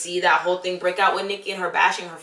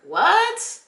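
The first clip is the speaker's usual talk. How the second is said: incredulous what; 13 semitones higher than usual. Real, not acted. Disbelief at the bystanders.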